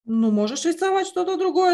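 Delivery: normal talking voice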